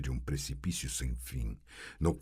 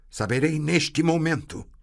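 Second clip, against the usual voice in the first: higher, louder defiant cry; forceful defiance; defiant shout; 11 semitones higher, 7 dB louder than usual. A narrator's reading. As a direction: demand it with force. Sorceress defiant.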